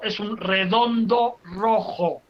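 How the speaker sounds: slow, long O in "redondo" and "rojo"; teacher drawing out each O in "redondo rojo"; stress on O. Shown as a demonstration. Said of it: "todo asentado en la O".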